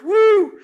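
high-pitched, full-voiced "woo!"; loud high whoop. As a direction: let out a big high whoop. Exclaims about tough kids.

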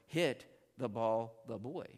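put-on slow, stressed "Hit", pause before "the boy"; word-order demo. Shown as a demonstration. Teacher showing another Greek word order, slow chunked demo. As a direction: punch "Hit", then set out each chunk slowly. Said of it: "it might be written as".

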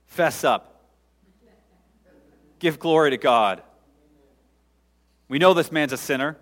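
loud, forceful acted accusation; acting out interrogators demanding a confession; stern demand. Interrogators pressing the healed man.

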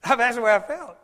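loud laugh as he says it. Real, not acted. Preacher laughing at his own story, loud and high. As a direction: laugh through the line.

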